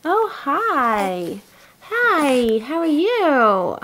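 slow, swooping high greeting. Real, not acted. Mother asking her baby how she is, cooing baby talk.

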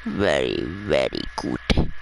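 put-on very low, slow goofy accent on Very, very good; put-on accent again. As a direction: stretch "Very, very good" out deep in a made-up accent. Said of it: "The accent I just made".

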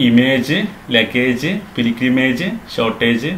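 ഇമേജ്, ലഗേജ്, പിൽഗ്രിമേജ്, ഷോർട്ടേജ് all ending in long "-ayj"; common mispronunciations. Shown as a demonstration. Teacher listing what to avoid, "-ayj" on ഇമേജ്, ലഗേജ്, പിൽഗ്രിമേജ്, ഷോർട്ടേജ്. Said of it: "എന്നൊന്നും പറയരുത്".

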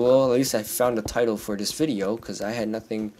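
normal talking voice

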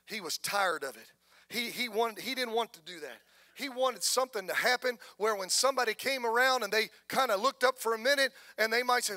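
ordinary talk, nothing marked

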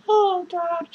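ordinary delivery

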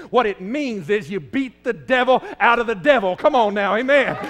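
ordinary talk, nothing marked

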